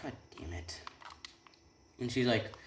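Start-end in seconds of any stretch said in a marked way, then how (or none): 0.0-0.8 much softer curse, almost under the breath; muttered to himself. Acted. Hero cursing inwardly at his bad luck, soft muttered curse. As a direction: grumble it inward. Hero's inner thought, muttered.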